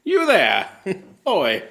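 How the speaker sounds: high, loud barker calling out; carnival barker voice. Acted. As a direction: call out to the crowd like a showman. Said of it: "That's my carnival barker voice".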